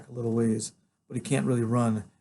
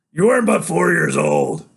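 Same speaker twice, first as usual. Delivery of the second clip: loud, raised rebuttal; forceful denial; 6 semitones higher, 11 dB louder than usual. Acted. Father denying his son's memory, loud forceful rebuttal. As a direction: throw the accusation back at him loudly.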